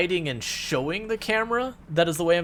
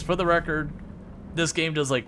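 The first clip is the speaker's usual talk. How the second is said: usual speaking voice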